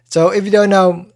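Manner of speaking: normal talking voice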